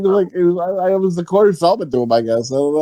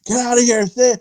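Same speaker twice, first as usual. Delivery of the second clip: ordinary talk, nothing marked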